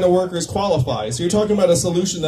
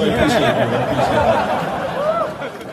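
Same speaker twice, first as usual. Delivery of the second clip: laughing thanks; 8 semitones higher than usual. Real, not acted. Thanking the lone booing audience member.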